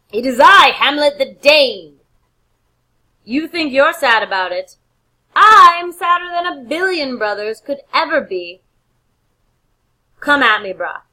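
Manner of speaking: loud, high proclamation with a bold boast; shouting "It is I, Hamlet the Dane"; bellowing challenge. Retold or imitated. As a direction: announce yourself at full volume, then dare him. Hamlet bursts in at funeral.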